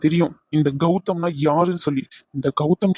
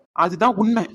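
normal talking voice

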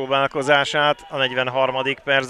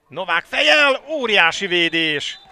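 excited commentary; 8 semitones higher, 7 dB louder than usual. Real, not acted. Big save called live.

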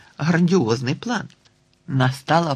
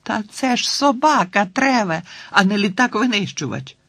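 high, scoffing retort; exasperated girl; 7 semitones higher than usual. Acted. Girl scoffing at her friend, high and exasperated. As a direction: scoff at him, it's only a dog. Tina mocks the boy.

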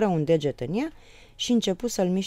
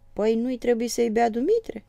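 higher, puzzled question; puzzled exclamation; 7 semitones higher, 5 dB louder than usual. A narrator's reading. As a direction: wonder why he'd even ask. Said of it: "exclamă Moromete nedumerit". (exclamație nedumerită)

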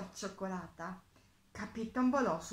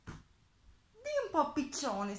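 higher, drawn-out little mole voice; small animal piping up slowly; squeaky mole; 6 semitones higher, slower than usual. A narrator's reading. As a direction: squeak it at the pigeon. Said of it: "squittì".

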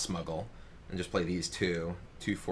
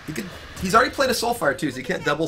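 excited; 8 semitones higher, 5 dB louder than usual. Real, not acted. Excited analysis.

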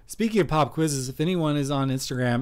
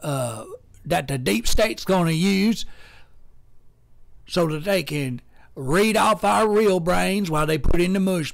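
higher, louder conspiracy rant as Mater; 3 semitones higher, 3 dB louder than usual. Shown as a demonstration. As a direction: rant like a wound-up Southern tow truck. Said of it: "This is Mater".

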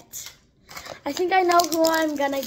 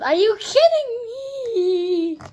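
exasperated whine; 5 semitones higher, slower than usual. Real, not acted. Unboxing the unwanted doll, whining in dismay. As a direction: groan at the bad luck. Disappointed again by Mentos.

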